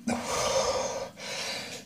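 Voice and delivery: long unvoiced sigh; heavy sigh. Real, not acted. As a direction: exhale hard in pain. Chili pain, second sigh.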